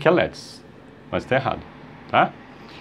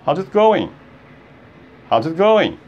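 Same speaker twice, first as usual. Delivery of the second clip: quick, contracted, higher pitch; normal fast form; 4 semitones higher than usual. Shown as a demonstration. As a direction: say "How's it going" as one natural run. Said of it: "Aí seria o normal". (ritmo normal, tudo emendado)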